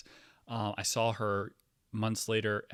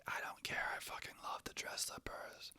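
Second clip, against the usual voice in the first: unvoiced, softer whispered come-on; husky whisper; 12 dB quieter than usual. Flirty worker whispering to the man to come anyway, unvoiced whisper. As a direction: whisper it like a secret invitation. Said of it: "She's like".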